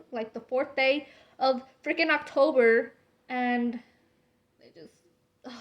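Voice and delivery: ordinary talk, nothing marked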